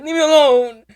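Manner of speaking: mock teary kid; whiny plea. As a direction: beg to be left alone. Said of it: "I was like".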